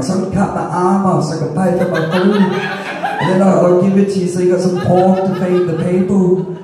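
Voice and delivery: can't hold back the giggles; laughing through it. Real, not acted. Telling the pork server his plan, laughing. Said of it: "And I'm like".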